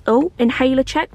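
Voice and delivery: ordinary talk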